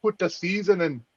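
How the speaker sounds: hammy fake Jamaican accent. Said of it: "That was a terrible Jamaican accent".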